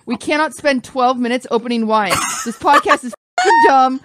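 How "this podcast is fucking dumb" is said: loud, raised-pitch exasperation; frustrated outburst. Real, not acted. Host fed up with the wine opener, loud exasperation. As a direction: burst out fed up, loud and high.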